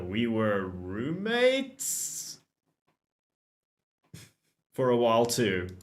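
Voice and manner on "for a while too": ordinary talk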